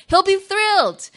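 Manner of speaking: excited. Real, not acted. Describing son getting another episode.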